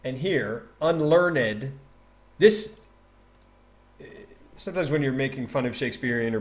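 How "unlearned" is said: "unlearned" in three syllables, un-LEARN-ed; sounded -ed. Shown as a demonstration. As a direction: give "unlearned" its extra final syllable. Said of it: "it's unlearned".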